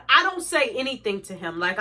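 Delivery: usual speaking voice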